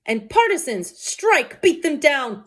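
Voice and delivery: high, loud rallying shout; crowd battle cry. Acted. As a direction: rouse the mob to strike. Citizens' battle cry in the play.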